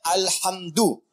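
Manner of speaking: alhamdu with a clear u ending; correct ending. Shown as a demonstration. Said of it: "Bukan alhamda pakai fathah atau alhamdi pakai kasrah". (alhamdu dibaca dengan akhiran u (dhammah))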